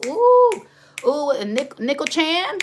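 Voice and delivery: high, loud delighted squeal on the ooh; excited squeal. Real, not acted. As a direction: shriek with joy at the name. Reacts delighted to a viewer's name.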